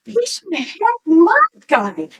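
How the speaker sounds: high, drawn-out retold exclamation; emphatic explaining. Mother tells daughter who Hoffa is, emphatically.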